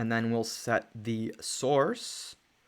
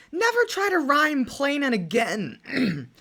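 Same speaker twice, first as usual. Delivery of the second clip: exasperated; 11 semitones higher, 6 dB louder than usual. Real, not acted. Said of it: "reacting to bad rhyme".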